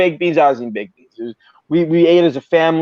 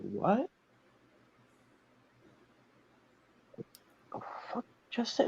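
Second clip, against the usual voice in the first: breathless whispered disbelief; stunned whisper; 5 semitones higher, 20 dB quieter than usual. Retold or imitated. Hearing a stranger insult him in line, a shocked whisper. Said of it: "I'm like".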